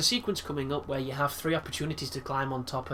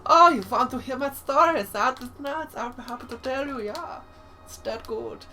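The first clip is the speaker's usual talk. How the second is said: high camp German scientist, loud at the start and then fading; theatrical, campy German professor voice; 10 semitones higher, 5 dB louder than usual. Acted. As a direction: ham up a flamboyant German boffin.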